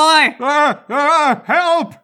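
high, loud squealing cry for help; a pinned old man squealing; shrill frightened yelp; panicked old man cry. Acted. Tackled old congressman cries out.

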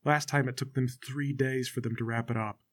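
very low, soft grumbling; crowd murmur. Acted. Spectator complaining in the crowd, discontented murmur. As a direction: mutter it under your breath to a neighbour. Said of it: "the crowd starts to murmur".

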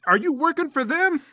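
high, loud demand; accusing question. Acted. Fighter turns to the unmasked rival, accusing. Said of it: "Namir turns to you".

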